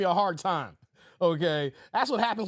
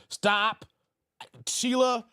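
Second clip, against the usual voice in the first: ordinary talk, nothing marked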